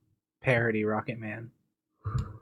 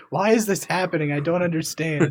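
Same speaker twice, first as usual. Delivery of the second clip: louder, raised mock exasperation; frazzled DJ; 4 semitones higher, 4 dB louder than usual. Retelling a Simpsons radio joke, frazzled DJ. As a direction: imitate a DJ losing his grip. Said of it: "this frazzled D J who's like".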